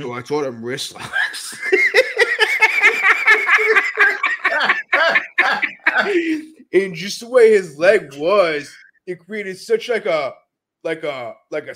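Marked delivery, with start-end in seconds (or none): 1.6-6.5 loud, high cackling laughter; long burst of helpless laughing; big laugh. Real, not acted. Laughing over teaching a teammate wrist locks, loud and high.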